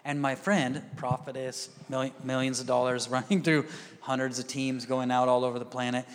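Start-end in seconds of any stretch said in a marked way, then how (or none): none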